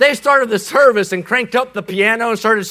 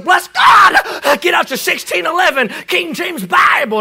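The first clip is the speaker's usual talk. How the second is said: high, strained pulpit scream; explosive mock preacher's yell, easing slightly after the opening; 9 semitones higher, 3 dB louder than usual. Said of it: "I mean, he screamed".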